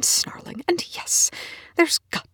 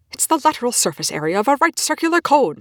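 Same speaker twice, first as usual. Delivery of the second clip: louder, higher, hammering each word; insistent repeat; 6 semitones higher, 5 dB louder than usual. Acted. Scientist repeating the answer to his colleague, insistent and loud. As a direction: drill the answer into her. Repeats while shaking her.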